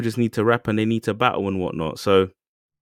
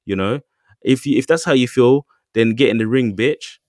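high, louder mock confrontation; mock angry challenge; 6 semitones higher, 5 dB louder than usual. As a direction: square up to him, fired up. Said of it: "And then [name]".

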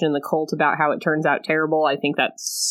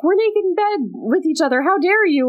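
mock scream; 11 semitones higher than usual. A lady scandalised to wake naked beside him, mock screaming. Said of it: "start screaming and be like".

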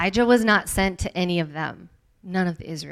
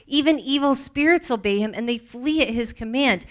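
higher, louder wonder; awed crowd exclaiming, higher and louder; amazed exclaiming; 5 semitones higher, 4 dB louder than usual. Acted. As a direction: marvel at his power. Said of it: "the people exclaimed".

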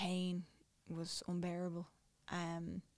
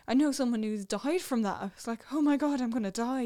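alarmed; 5 semitones higher, 8 dB louder than usual. Retold or imitated. Retelling fear of dying.

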